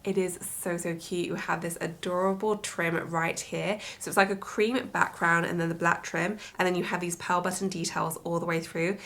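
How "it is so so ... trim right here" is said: ordinary talk, nothing marked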